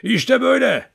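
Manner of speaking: put-on old man howls like a wolf to show the dogs' trick; howling like a wolf. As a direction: howl it out like a wolf with hands cupped. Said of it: "kurt gibi ulumaya başladı".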